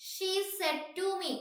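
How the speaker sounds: high, drawn-out reading; slow dictation. Real, not acted. Teacher repeating the question to students, slow dictation. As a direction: read it out slowly, stretching each word.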